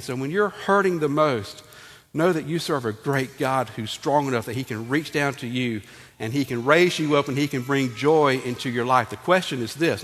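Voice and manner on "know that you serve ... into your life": usual speaking voice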